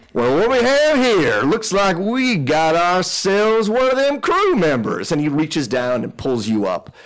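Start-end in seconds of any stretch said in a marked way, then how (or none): 0.1-5.1 high, swooping character voice; henchman boss discovering someone. Acted. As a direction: stretch it out like a gloating crook. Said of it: "leans over and goes".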